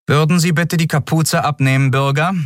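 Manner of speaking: a polite order; polite but firm guard. A narrator's reading.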